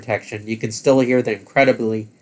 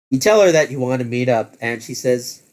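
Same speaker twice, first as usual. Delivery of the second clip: ordinary talk, nothing marked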